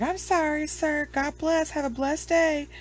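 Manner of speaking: high-pitched, placating sing-out; mock polite apology. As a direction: imitate a mom backing off politely. Imitating mom's sudden sweet apology.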